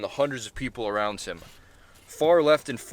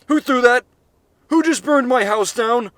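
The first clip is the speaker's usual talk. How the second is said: much higher, much louder scream; 12 semitones higher, 11 dB louder than usual. Acted. Gary screams at the crowd over his burned house. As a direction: scream it, furious and devastated. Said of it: "He screamed at the crowd".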